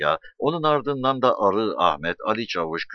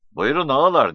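ordinary delivery

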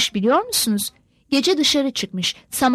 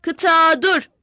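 loud, higher barked drill commands; drill shout; 5 semitones higher, 6 dB louder than usual. A narrator's reading. NCO yells drill orders at the recruits, loud. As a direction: bellow at the recruits on the parade ground. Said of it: "Astsubaylar bağırıp dururdu".

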